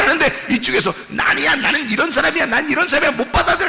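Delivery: high, insistent sermon delivery; impassioned preaching. Real, not acted. Preacher acting out God's word pushing against the old self, impassioned preaching. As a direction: press the struggle home urgently.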